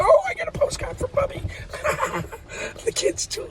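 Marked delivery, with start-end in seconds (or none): 0.0-1.4 delighted postcard squeal. Retold or imitated. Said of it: "Nothing makes me more happy when I go". 1.5-2.8 a high, airy burst of laughter; breathy high laugh. Real, not acted. Vlogger laughing at his kids' postcard story, high airy laugh.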